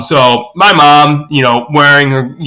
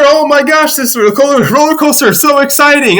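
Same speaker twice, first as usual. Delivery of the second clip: high-pitched imitation of a coaster rider; hammed-up excitement, pitched way up; mock thrill; 12 semitones higher than usual. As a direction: gush like a typical rider on the drop. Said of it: "your typical throw your hands in the air".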